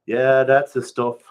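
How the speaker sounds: loud, slow pidgin approval; loud approving. Acted. Narrator tells Arlie his rocks are good, loud slow approval. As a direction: praise the bucket of rocks.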